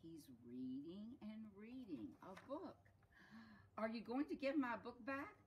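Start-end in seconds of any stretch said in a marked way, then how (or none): 3.8-5.3 high, loud storybook question; pleading monkey. Acted. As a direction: ask loudly for it back, voice raised. Monkey demands book back from donkey.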